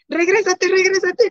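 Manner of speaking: very high call to turn back; husband calling back. Retold or imitated. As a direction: call her back through the rain. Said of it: "mi esposo". (esposo gritando que regrese)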